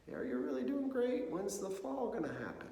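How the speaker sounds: high, loud mocking inner doubt. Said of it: "and I'm like".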